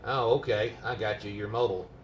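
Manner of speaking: ordinary talk, nothing marked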